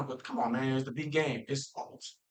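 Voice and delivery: ordinary talk, nothing marked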